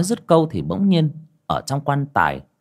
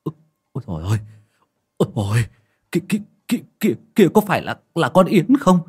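terrified stammering; 10 semitones higher, 7 dB louder, much faster than usual. A narrator's reading. Mourner spotting a ghost at the gate, stammering in terror. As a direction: stutter in fright, rising to a high loud cry. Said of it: "mà ú ớ".

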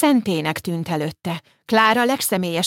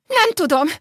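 shrill near-scream, much higher and louder; almost screaming; 12 semitones higher, 4 dB louder than usual. Acted. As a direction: blurt it out in panic, nearly shrieking. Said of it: "A lány szinte sikoltott". (szinte sikoltva, pánikban)

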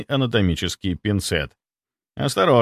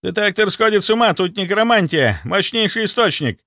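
high-pitched, agitated report; urgent shouted alarm; 10 semitones higher, 4 dB louder than usual. A narrator's reading. Inquisitor alerting the captain to necromancy, urgent shout. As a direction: raise the alarm, pitch right up. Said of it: "вдруг заорал инквизитор Кравченко от холодильных камер".

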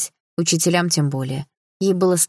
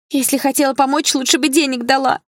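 higher-pitched indignant retort; tearful-girl reproach, raised pitch; upset reproach; 8 semitones higher, 4 dB louder than usual. A narrator's reading. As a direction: throw it back, hurt and higher. Upset girl snaps back at helper.

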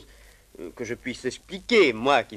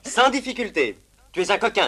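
loud dismissive verdict; 6 semitones higher, 7 dB louder than usual. Acted. Master pronounces judgment loudly.

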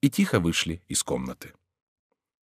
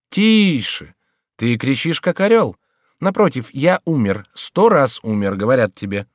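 higher, louder teasing voice; mocking jester Chicot; 4 semitones higher, 5 dB louder than usual. A narrator's reading. Chicot's ghost mocks the frightened king, higher and louder. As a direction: taunt the king like a sly jester.

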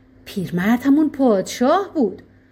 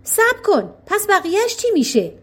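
shout; 9 semitones higher than usual. Acted. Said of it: "فریاد زد".

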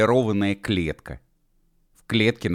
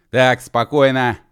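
raised, higher, slow command; urgent call; 5 semitones higher, 8 dB louder, slower than usual. Acted. Mashkov as the gates slam shut, urgent loud call. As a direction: call for calm, loud and drawn out.

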